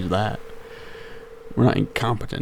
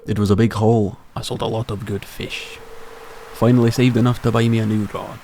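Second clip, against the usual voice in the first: hearty Scottish-tinged sailor brogue; Willie, Scottish accent. Acted. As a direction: play an old fisherman with a makeshift Scottish accent. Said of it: "doing a Scottish accent for Willie".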